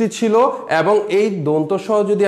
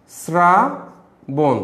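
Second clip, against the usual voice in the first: Shrabon stretched very slowly, s-ra clear; drawn-out pronunciation; much slower than usual. Shown as a demonstration. Teacher spells Shrabon aloud, very slowly. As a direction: draw out Shrabon syllable by syllable. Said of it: "এটা উচ্চারিত বানান হবে".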